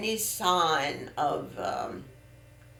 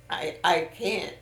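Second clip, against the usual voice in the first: ordinary delivery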